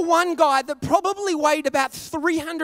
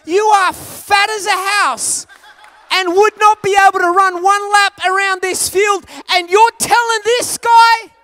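incredulous rant; 7 semitones higher, 11 dB louder than usual. Retold or imitated. Inner rant at heckler.

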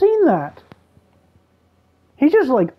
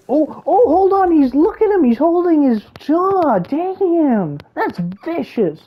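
thrilled gasping; 8 semitones higher than usual. Real, not acted. Player watches the dinosaur grip its prey's jaw, much higher and excited. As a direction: pitch way up, awed by the attack. Watching a vicious dino kill.

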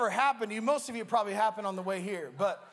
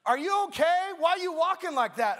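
put-on higher and louder, puzzled girlfriend; girlfriend's worried voice; 5 semitones higher, 5 dB louder than usual. Girlfriend asks why he walks strangely, puzzled. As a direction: voice her alarm at his odd walk.

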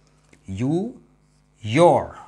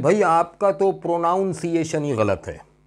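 raised, mock-indignant retort; mocking a know-it-all; 7 semitones higher, 3 dB louder than usual. Teacher mocks students who correct others wrongly. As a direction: imitate a smug corrector. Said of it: "pronounce गलत करते हैं और कहते हैं".

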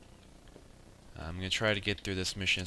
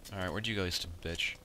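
ordinary talk, nothing marked

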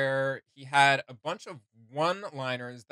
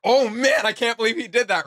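imagined character reacting; 7 semitones higher, 9 dB louder than usual. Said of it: "they'd be like".

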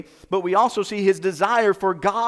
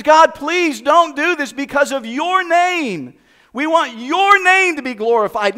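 louder, higher impassioned plea to God; pleading prayer; 8 semitones higher, 7 dB louder, slower than usual. Retold or imitated. Moses pleading with God for his name's sake, loud earnest plea. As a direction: beg God earnestly, voice lifted.

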